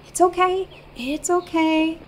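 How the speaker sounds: calm the frightened animal; soothing. Acted. Traveler soothing a trapped rabbit, gentle and slow.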